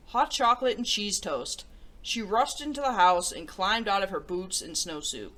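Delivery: put-on reading a picture book to children; storybook read-aloud voice; story narration. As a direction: tell it gently to young listeners.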